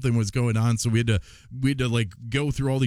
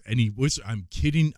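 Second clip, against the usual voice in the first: usual speaking voice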